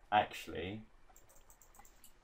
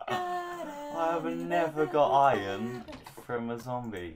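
high, loud, drawn-out exclamation; amazed excitement; 7 semitones higher, 8 dB louder, slower than usual. Real, not acted. Player amazed at iron from a zombie, excitedly exclaiming. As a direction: stretch it out in thrilled disbelief.

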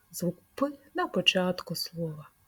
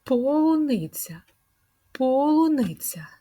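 "Полуниця" said slowly and higher, each syllable clear; slow model word; 6 semitones higher than usual. Shown as a demonstration. Speech teacher to little kids, slow clear model word. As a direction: stretch "Полуниця" out slowly for the children. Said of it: "Є звук П?".

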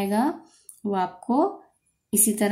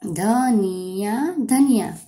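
धनिया drawn out slowly, rising at end; sounding out slowly; much slower than usual. Shown as a demonstration. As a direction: stretch धनिया gently.

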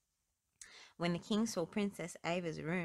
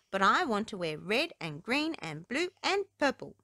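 a touch of a pleading princess; mild pleading to her father; 3 semitones higher, 7 dB louder than usual. Acted. Princess begging the king for colours, mildly pleading. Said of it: "Princess Ava pleaded".

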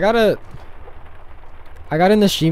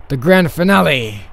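louder, proclaiming flourish; grand announcement; 2 semitones higher, 8 dB louder than usual. Acted. Letter writer unveils the grand finale, grand announcement. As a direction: announce it like a showpiece.

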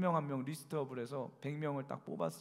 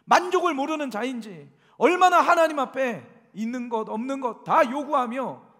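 much louder, higher preaching climax; impassioned preaching; 9 semitones higher, 14 dB louder than usual. Real, not acted. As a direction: pour out the confession from the pulpit at full force.

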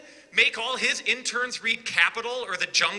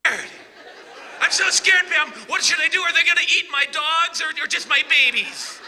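high, loud mock-terrified pleading; hammed-up fake fright, higher and louder; sarcastic mock panic; 7 semitones higher, 5 dB louder than usual. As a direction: ham up being scared of socialists to ridicule Pam. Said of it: "not to be sarcastic".

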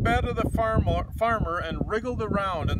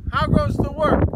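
louder farmer call; 4 semitones higher, 5 dB louder than usual. Acted. Lazy farmer calls to the duck, loud. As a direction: call it out from the bed.

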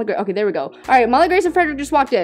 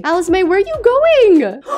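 calling after her; 7 semitones higher, 3 dB louder than usual. Pretend. Calls after departing Sim.

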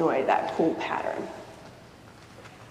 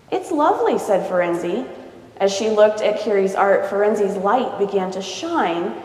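lively read-aloud storybook voice; story narration; 3 semitones higher, 6 dB louder than usual. A narrator's reading. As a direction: read it to a child, voicing each character. Reads picture book aloud.

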